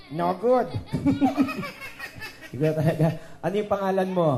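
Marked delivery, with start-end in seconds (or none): 0.7-2.5 high, breathy laughter; laughing. Real, not acted. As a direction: crack up in a high wheeze. Amused by the mistaken echo. (matinis at humihingal na tawa)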